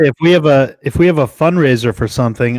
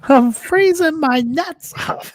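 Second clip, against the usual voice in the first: high, slow comedian impression; Gilbert Gottfried; much slower than usual. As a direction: stretch each word high and slow. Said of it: "Gilbert comes on and says that".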